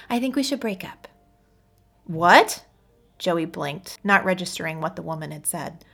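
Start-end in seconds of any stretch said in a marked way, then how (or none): 0.1-1.1 said quickly. Acted. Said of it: "she said quickly". 2.1-2.6 loud, rising shocked question; shocked what. Acted. Shocked reaction.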